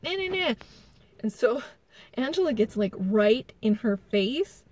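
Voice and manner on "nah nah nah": high, taunting singsong imitating Rachel; taunting nah nah. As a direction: tease like a child pointing a finger. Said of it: "sticking her finger".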